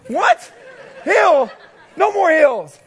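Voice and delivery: high, loud, laughing disbelief; "Hill?" cried out high with a laugh; incredulous disbelief. Retold or imitated. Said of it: "And I'm like".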